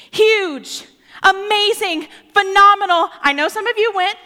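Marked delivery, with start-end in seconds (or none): 0.1-3.1 louder and higher with mock hype; hyped up. Preacher hyping the Eras Tour to the congregation, excited. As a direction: gush like a superfan.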